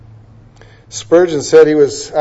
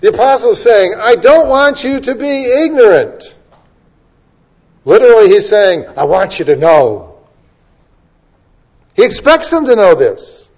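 louder, higher pulpit voice, driving each phrase; rising preaching; 5 semitones higher, 3 dB louder than usual. Acted. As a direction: preach it with growing force to the congregation. Preaching at full force.

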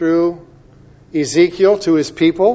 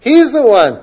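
fervent preaching; 4 semitones higher, 6 dB louder than usual. Acted. Preaching Psalm 23 climax.

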